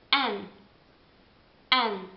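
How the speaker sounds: N said as en; English N. Shown as a demonstration. Teacher drilling English N. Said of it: "è la N".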